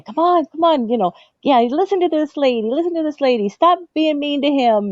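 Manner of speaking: high, teasing referee working the crowd; hammed-up referee. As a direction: egg the crowd on like a showman ref.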